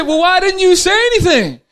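high, loud disbelief; astonished pilot. Acted. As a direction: pilot stunned that the husband said nothing. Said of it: "He said".

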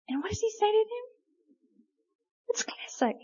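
breathy whispered aside; hushed amused whisper. Real, not acted. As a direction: drop to a whisper, savoring it. Aside before Jesus' answer.

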